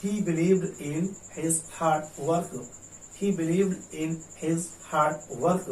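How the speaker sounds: very slow, every word clear; slow model read. Shown as a demonstration. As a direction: read it slowly twice. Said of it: "तीसरा sentence देखिए". (धीरे-धीरे पढ़ना)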